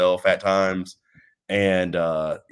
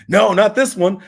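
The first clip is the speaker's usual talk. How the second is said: higher, louder imitation of excitement; excited; 10 semitones higher, 5 dB louder than usual. Friend insists this trivia book is different, excited. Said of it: "He was so excited".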